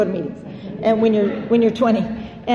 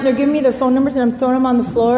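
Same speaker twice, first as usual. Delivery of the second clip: ordinary delivery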